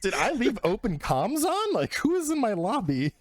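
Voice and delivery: hammed-up confusion about the lobby; mock panic. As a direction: sound alarmed someone is listening in. Panicked wondering about comms.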